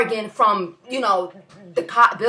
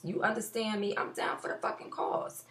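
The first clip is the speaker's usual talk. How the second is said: whispered aside; 11 dB quieter than usual. Real, not acted.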